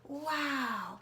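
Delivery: usual speaking voice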